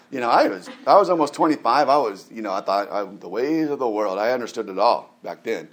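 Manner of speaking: ordinary talk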